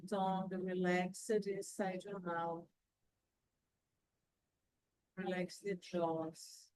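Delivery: calm breathing guide, unhurried; guided meditation. Acted. Leads a guided breathing relaxation.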